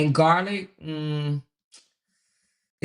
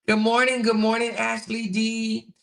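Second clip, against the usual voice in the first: high, bright sing-song good morning; chirpy hello to viewers; sing-song greeting; 6 semitones higher than usual. Acted. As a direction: greet the chat in a lifted voice.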